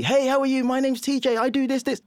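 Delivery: high, loud bubbly greeting; overly cheerful. Retold or imitated. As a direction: bounce in like a loud salesman. Retelling his loud upbeat intro.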